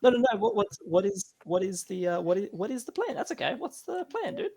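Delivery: flustered. Pretend. GM flustered, scrambling for plan.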